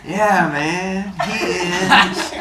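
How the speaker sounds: laughing through a hippie voice; giggly laid-back hippie. Acted. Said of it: "Try it".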